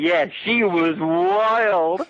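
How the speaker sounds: very slow, knowing drawl; drawled reply. Retold or imitated. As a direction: stretch it out knowingly. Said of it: "He's like".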